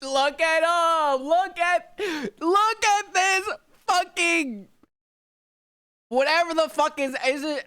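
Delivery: very high, drawn-out excited wail; overwhelmed fanboy awe. Real, not acted. Fan seeing the Space Marine transformation, overwhelmed squeal. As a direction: strain high and slow, beside yourself with excitement.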